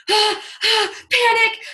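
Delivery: high, loud playful panic yelps; mock panic. Dreading the phone call, a hammed-up panicked yelp. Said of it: "I hoped she wouldn't answer".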